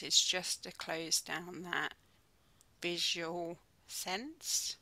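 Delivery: calm, unhurried mindfulness guide with long pauses; soothing body-scan voice, phrase by phrase; guided meditation. Real, not acted. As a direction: ease them gently into stillness, leaving space between phrases.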